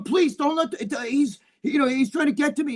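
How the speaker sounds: high, imitated frightened plea; scared pleading. Kid hiding behind the cops he berated, high mock-scared plea. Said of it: "was scared of him".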